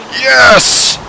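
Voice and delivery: high cheer of triumph; excited cheer. Real, not acted. Gamer cheering his Pokemon's win.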